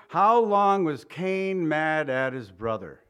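usual speaking voice

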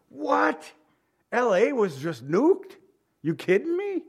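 high-pitched, incredulous questions; acted shock. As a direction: react in mock astonishment to terrible news. Acting out hearing shocking news.

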